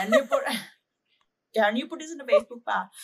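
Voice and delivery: loud, laughing Indian dad impression; dad's Indian accent. Daughter as dad asking about Facebook, Indian accent. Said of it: "say the way he says your name".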